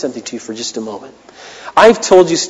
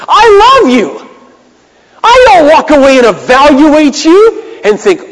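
higher and louder, the fiery pulpit voice; full-force preaching; 12 semitones higher, 7 dB louder, slower than usual. Acted. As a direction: preach it out, slow and ringing on each line. Pastor preaching, emphatic.

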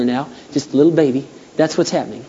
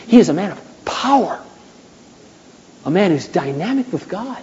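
ordinary talk

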